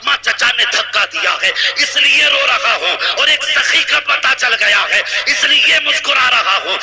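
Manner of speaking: impassioned preacher's crescendo; rising preaching climax. Acted. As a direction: build the fervour line by line to a ringing peak.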